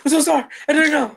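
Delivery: very high, loud, squeaky apology; mocking flustered coworker. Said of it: "It's like".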